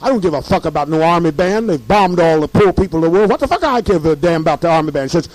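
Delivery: ordinary delivery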